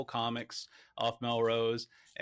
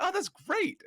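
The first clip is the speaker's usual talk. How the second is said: much higher-pitched mock-thrilled reaction; retold delight; 4 dB louder, slower than usual. Interviewer recalls hearing the artist's answer, mock delight. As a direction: squeal it lightly, as a retold fan reaction.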